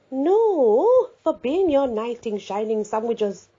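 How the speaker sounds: high, loud playful "No"; teasing. A narrator's reading. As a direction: tease him, drawn out. Teasing reply about lunch hug.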